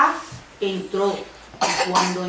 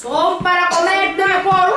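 slow, raised wolf voice; big bad wolf building to the attack; pouncing wolf; 6 semitones higher, 3 dB louder, slower than usual. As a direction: draw it out, pitched up, ready to pounce.

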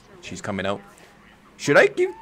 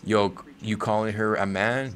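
normal talking voice

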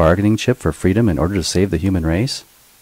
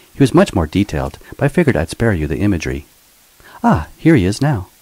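higher, teasing robot voice with a mocking edge; cheeky robot sidekick; 5 semitones higher, 4 dB louder than usual. Bot Minx taunts her former master in the cell: higher, teasing robot voice. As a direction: play the sassy bot needling her old master.

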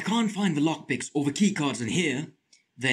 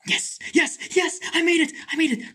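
excited whisper; 13 semitones higher than usual. Real, not acted. Escapes, hiding.

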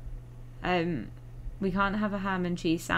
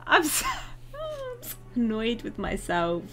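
laughing at herself; 4 semitones higher than usual. Real, not acted. Laughing at own blunder.

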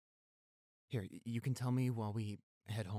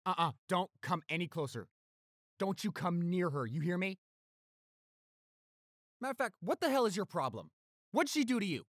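angry warning; 8 semitones higher, 5 dB louder than usual. Acted. Warning attacker away.

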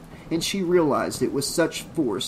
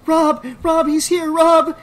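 hysterical high, loud screaming; panicked scream; 9 dB louder than usual. A narrator's reading. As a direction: scream his name in blind panic. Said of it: "she screamed, panic-stricken and inconsolably hysterical".

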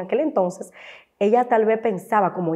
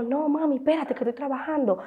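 lightly acted busy mother, softer and higher; mamá ocupada; 6 semitones higher, 6 dB quieter than usual. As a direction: put off the child in a soft, raised voice. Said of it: "Y yo". (mamá que pide paciencia, bajito)

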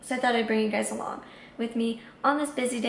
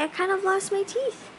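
voicing the dog, higher; puppy voice; 8 semitones higher than usual. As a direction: say it as the lisping puppy.

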